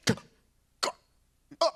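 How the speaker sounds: short choked stuck noises; mock brain-freeze gasps; imitated speechless stammer. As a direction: stall out in broken grunts. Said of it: "And he was just like".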